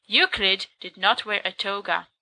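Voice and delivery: ordinary delivery, nothing marked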